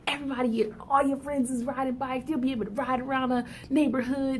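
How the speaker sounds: pumping up. Acted. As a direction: hype up a hesitant child. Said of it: "you pump their head up".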